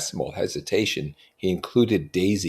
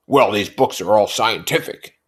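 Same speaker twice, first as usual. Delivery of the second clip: insisting; 4 semitones higher, 7 dB louder than usual. A narrator's reading. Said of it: "insisted Tom".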